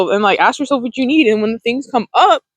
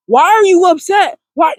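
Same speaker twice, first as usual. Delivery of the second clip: higher, louder accusing demand; accusatory; 6 semitones higher, 4 dB louder than usual. Shown as a demonstration. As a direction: hammer the question like an accusation. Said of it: "not in an accusatory way".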